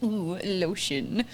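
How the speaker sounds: slow, soft, put-on dog voice. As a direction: savour it slowly as the dog. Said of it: "He's like".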